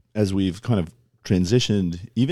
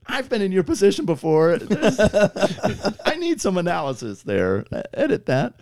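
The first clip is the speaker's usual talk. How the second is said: mock colleague; 13 semitones higher than usual.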